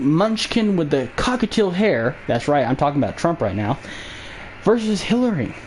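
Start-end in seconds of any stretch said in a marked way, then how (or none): none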